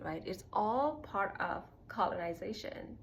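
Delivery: normal talking voice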